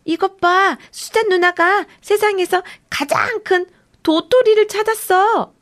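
high, loud excited shout. Acted. Boy shouting about his sister's huge acorn, high and loud. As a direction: announce it to everyone. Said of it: "빌리가 외쳤어요".

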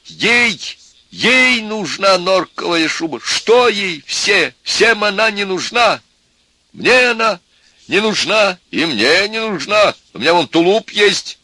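high, loud, slow insistence; heated outburst. A narrator's reading. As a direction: hammer it home in exasperation. Heated outburst over the fur coat.

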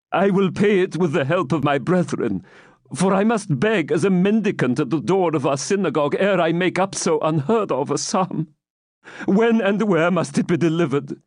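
put-on high, anxious old man; pleading old man. Captive bargaining with the baron, anxious plea. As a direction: bargain with a trembling heart.

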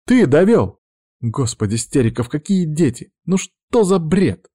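high, indignant woman's voice; exasperated outburst. A narrator's reading. Witch fed up with the man's talk, exasperated and high. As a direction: burst out in exasperation.

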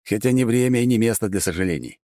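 usual speaking voice

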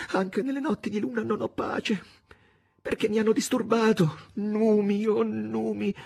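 high-pitched, anguished plaint; trembling murmured lament. A narrator's reading. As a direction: lament to the moon in distress. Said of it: "cominciò a mormorare sussultando".

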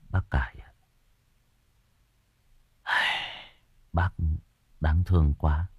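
low, halting words with long pauses; murmured. A narrator's reading. Narrator consoling old Cải at the grave, murmured low. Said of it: "Tôi thì thầm".

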